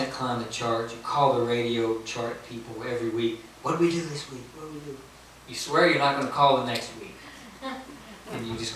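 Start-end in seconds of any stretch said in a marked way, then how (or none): 3.6-5.0 mock impatient caller wanting news; eager pestering. Songwriter calling radio chart people every week, eagerly pestering.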